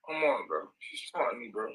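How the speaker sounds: low, soft and beaten down; hurt, low and soft; dejected. Real, not acted. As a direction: let it sink, defeated and quiet. Hurt, talking about his daughter.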